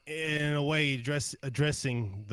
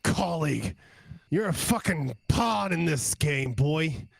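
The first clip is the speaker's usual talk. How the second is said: sneering, raised and higher goblin taunt; angry hobgoblin; 4 semitones higher, 3 dB louder than usual. Acted. Tied-up hobgoblin sneers at Fiverr, angry raised voice. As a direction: spit it at him like a captive brute.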